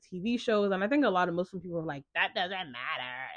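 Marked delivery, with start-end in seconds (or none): 2.1-3.3 slow, softer put-on brush-off; mocking, stretched-out dismissal; mock dismissive. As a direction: imitate people waving it away, drawn out. Mimicking people dismissing compatibility.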